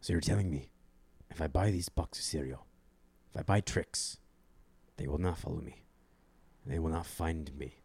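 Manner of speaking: low, quiet, deliberate gravity; hushed, deadly serious with long pauses; Liam Neeson. Acted. Grilling someone about buying cereal in a low, grave murmur. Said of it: "You ever see Liam Neeson in Ted two?".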